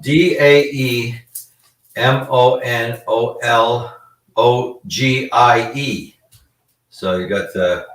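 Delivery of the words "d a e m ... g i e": slow, letter by letter spelling of daemonologie; spelled out letters. Shown as a demonstration. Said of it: "So it's spelled".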